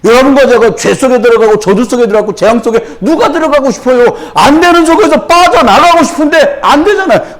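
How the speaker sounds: high, pressing sermon voice; full-force preaching. Real, not acted. Preacher describing people stuck in sin and curse, urgent high preaching. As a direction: push through the list without letting up.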